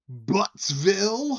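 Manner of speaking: loud, high, drawn-out punchline; mock proud announcement. Bar patron reveals his silly hometown, loudly announced. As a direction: belt the town name out slowly.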